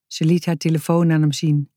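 ordinary delivery

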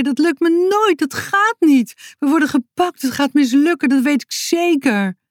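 put-on a boy losing his nerve before the rescue; panicky whining. A narrator's reading. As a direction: whine in rising dread. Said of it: "Zo bleef hij nog een tijdje doorjammeren".

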